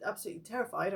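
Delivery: ordinary talk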